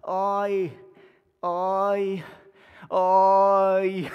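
a friend stuck for words on the phone; stammering. Retold or imitated. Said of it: "you hear him on the program going".

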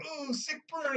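a retold put-down voiced way up high; mock high quip.

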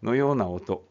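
ordinary talk

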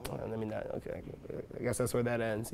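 very soft, muttered retreat; quiet backing down. Acted. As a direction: murmur it to yourself, giving up. Acts backing down after being brushed off.

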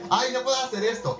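whiny anime character. Retold or imitated. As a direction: whine like a helpless anime hero. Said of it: "Que están siempre".

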